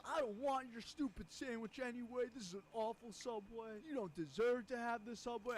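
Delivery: high, loud mock tirade; imitating a furious customer. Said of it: "starts going ballistic".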